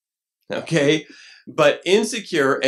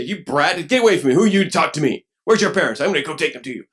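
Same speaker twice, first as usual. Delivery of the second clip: angry scolding; 3 semitones higher, 6 dB louder, faster than usual. Acted. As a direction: bark at the child to get lost. Fool snapping at a child.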